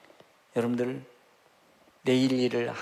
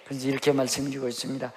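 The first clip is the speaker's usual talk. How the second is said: ordinary talk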